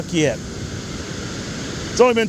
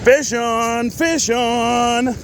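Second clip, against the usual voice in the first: excited; 8 semitones higher, much slower than usual. Real, not acted. Calling out a hooked fish.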